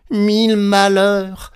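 slow, loud, high wail; anguished outcry. A narrator's reading. Petit Claus seizing the innkeeper, crying out. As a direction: cry out long and high in alarm. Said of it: "s'écria-t-il".

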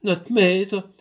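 a touch of panic, called out; flustered. Acted. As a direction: stumble in a rush. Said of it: "éperdue".

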